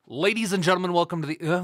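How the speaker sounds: big showman welcome; announcer intro. Acted. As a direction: open the show like a host. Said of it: "mad, I'm mad".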